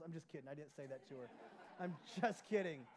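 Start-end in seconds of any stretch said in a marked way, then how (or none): 1.8-2.9 louder, laughing it off. Real, not acted. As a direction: chuckle through the words. Repeated with a laugh.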